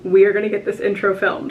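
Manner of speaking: ordinary talk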